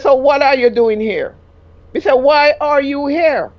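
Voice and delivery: retold angry question; indignant demand. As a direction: demand why he stays. Retold argument.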